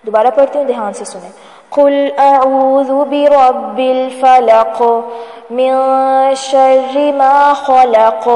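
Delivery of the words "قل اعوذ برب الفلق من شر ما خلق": slow, drawn-out tajweed chant; Quran recitation. Acted. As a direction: recite each verse slowly with long held vowels.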